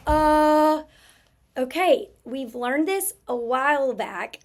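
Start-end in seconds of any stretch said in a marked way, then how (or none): 0.1-0.8 UH held long and loud on a level, higher pitch; isolated schwa sound. Shown as a demonstration. Teacher isolating the first sound of advisor, held schwa. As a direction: sound out a drawn-out UH. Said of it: "I hear".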